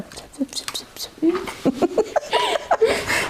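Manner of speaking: tiny whispered gibberish noises breaking into laughter; mock a barely audible answer, then laugh; teasing whispered mumble; imitated inaudible mumble. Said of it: "unless I ask her to say something".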